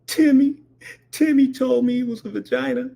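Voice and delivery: put-on high child's voice; high-pitched childlike answer; mock little kid. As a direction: imitate a kid telling on a friend. Scared child answering his dad.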